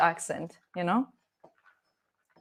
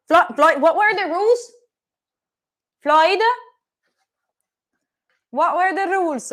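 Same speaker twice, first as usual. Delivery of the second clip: louder, higher scolding call; scolding the dog; 8 semitones higher, 7 dB louder than usual. Real, not acted.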